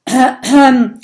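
loud, pointed throat-clear. A narrator's reading. Viking leader about to make a request, clearing his throat. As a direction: clear your throat for attention. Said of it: "Erik räuspert sich".

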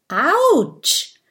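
high, loud mock yelp; pained cry. A narrator's reading. Minimo gets pricked in the arm, yelping. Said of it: "ruft der Wühldrache".

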